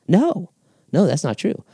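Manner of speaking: high-pitched, insistent denial; acted, earnest contradiction; emphatic reassurance. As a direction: jump up in pitch to dismiss the worry. Acted reply denying someone's assumption.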